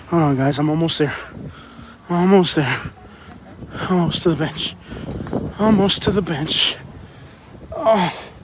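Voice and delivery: low, panting, with heavy sighs; out of breath. Real, not acted. Exhausted vlogger telling viewers he is almost there, out of breath. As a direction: gasp out short phrases as you struggle along. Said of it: "I'm so out of breath".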